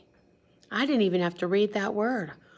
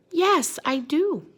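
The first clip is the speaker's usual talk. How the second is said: high, loud, slow eager answer; eager yes; 8 semitones higher, 7 dB louder, slower than usual. Acted. Squirrel accepting the invitation, high and eager. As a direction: say yes gladly. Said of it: "what does Mister Squirrel say".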